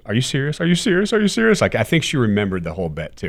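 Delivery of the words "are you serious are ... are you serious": rising higher with each repeat, mock-amazed mom; excited disbelief. Mom, realizing her son is buying her a truck, gets more excited.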